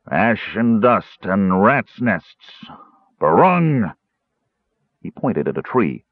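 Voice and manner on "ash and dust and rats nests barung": slow, slurred spell-casting; blurted drunken incantation. A narrator's reading. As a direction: chant a drunken spell, rising on the last word. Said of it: "he blurted out a string of gibberish".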